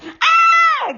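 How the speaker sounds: usual speaking voice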